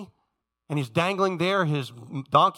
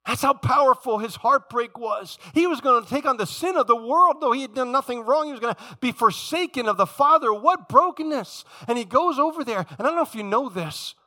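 high, raised preacher's voice; impassioned preaching; 7 semitones higher, 4 dB louder than usual. Real, not acted. Preacher proclaims to church how great Jesus' heartbreak was, impassioned. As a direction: preach it out with rising force.

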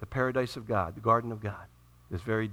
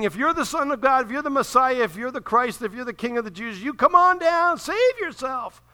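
higher, louder jeering taunt; mocking taunt; 9 semitones higher, 6 dB louder than usual. Soldiers taunting Jesus from below, loud jeering. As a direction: sneer each if you're up the scale. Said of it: "They're mocking him".

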